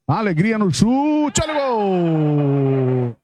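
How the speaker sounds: drawn-out goal scream, rising high; explosive goal commentary. Real, not acted. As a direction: stretch out the goal call. Goal called in live futsal commentary.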